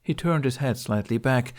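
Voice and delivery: usual speaking voice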